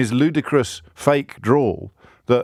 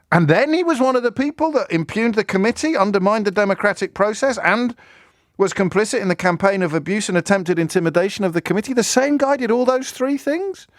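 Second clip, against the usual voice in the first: much higher, hammed-up astonished voice; mock disbelief; 11 semitones higher than usual. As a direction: feign wide-eyed shock at each charge. Sarcastic mock disbelief continues.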